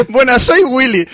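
high, loud, through giggles; can't stop laughing mid-line; laughing while reading. Real, not acted.

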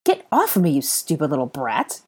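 irritated, revolted command; angry order. A narrator's reading. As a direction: order the child off. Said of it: "he ordered".

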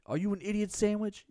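hammed-up chef asking; Gordon Ramsay. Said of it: "And he's like".

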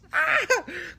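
squealed high giggle. Real, not acted. Flattered on the podcast, he giggles high. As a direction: let out a delighted high laugh. Flustered by compliment.

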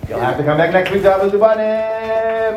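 high, stretched-out announcer call; sustained high sing-song announcement; calling out a sign-off. Real, not acted. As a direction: draw out the last words like a showman. Host calls out story's catchphrase ending.

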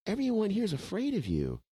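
pitched well up, soothing the big guy; flattering placation. Retold or imitated. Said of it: "I go".

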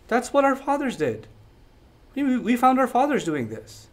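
matter-of-fact, softened answer; plain normal tone. Acted. Elders answering Ibrahim, in a very plain, normal tone. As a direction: say it evenly, like nothing is amiss. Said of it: "It was a very plain, normal tone".

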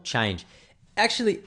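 ordinary delivery

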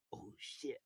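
hushed oh shit under the breath; whispered. Retold or imitated. Seeing too many girls named Grace, whispered shock. Whispered reaction to search results.

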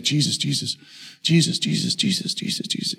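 soft sleep murmur fading to a whisper; imitated sleepy prayer; praying in sleep. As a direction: murmur it as if asleep, trailing into breath. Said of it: "She prays in her sleep".

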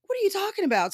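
high-pitched, baffled retort; imitated group bafflement, pitched high; mock incredulous. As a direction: throw it back in disbelief, voice way up.

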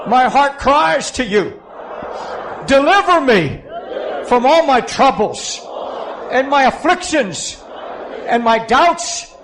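high, pleading cry to Jesus; gut-deep prayer. Real, not acted. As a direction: lead the congregation phrase by phrase, crying out from the gut. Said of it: "I want you to pray this from your gut".

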